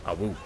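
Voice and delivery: playful little howl; short yelping howl, like a pup; howling like a wolf pup. Pretend. Dragon howl sounds while charging.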